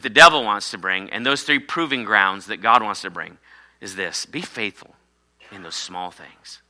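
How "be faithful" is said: normal talking voice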